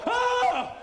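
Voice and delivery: high, sudden yelp of pain; pained high-pitched Ah!; cry of pain. Retold or imitated. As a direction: yelp like it really hurts. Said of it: "That's not joy. That's pain".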